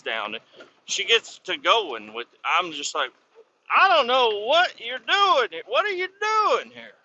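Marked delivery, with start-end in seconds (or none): 3.7-6.9 very high, incredulous mock outrage; exasperated protest. Driver's imagined protest to scale house girl, mock exasperated. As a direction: protest in disbelief, voice pitched way up.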